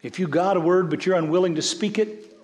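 high, loud, driving sermon voice; preaching at full force. Acted. Pastor urging the congregation to focus, full-force preaching. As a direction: preach it out hard to the room.

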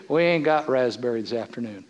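deadpan counter lady delivering bad news; Sonic clerk voice. Preacher voices the Sonic clerk, low and soft. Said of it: "the lady in there says".

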